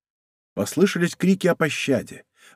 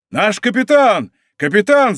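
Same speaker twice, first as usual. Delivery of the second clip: high, raised cries of recognition; joint shout; 5 semitones higher, 4 dB louder than usual. A narrator's reading. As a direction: hail your captain. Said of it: "закричали они в один голос".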